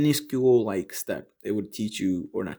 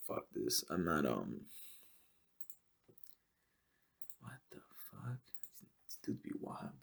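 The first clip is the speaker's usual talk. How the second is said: low, much softer grumbling whisper; muttered whisper; 6 semitones lower, 15 dB quieter than usual. Retold or imitated. Student quitting math homework, muttering in a low whisper. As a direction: grumble it to yourself, giving up. Quotes his giving-up thoughts.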